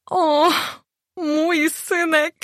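very high, slow, tearful cry of joy; mother overwhelmed with pride; moved mother. A narrator's reading. Matka wzruszona oświadczynami. (bardzo wysoki, wzruszony okrzyk matki)

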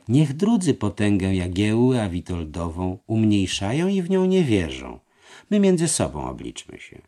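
voiced treasurer. A narrator's reading. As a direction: coax him into agreeing. Treasurer argues. (podskarbi przekonuje mistrza)

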